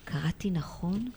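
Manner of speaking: soft slow whisper, almost no voice; hushed, unvoiced question to the teacher. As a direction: whisper the question so as not to disturb. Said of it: "אומר בקול חלש".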